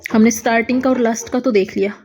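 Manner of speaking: ordinary delivery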